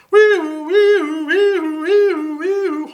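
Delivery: very high, loud siren wail; siren sound. Acted. The siren again.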